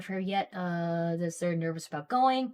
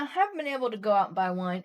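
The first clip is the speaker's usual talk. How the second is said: ordinary delivery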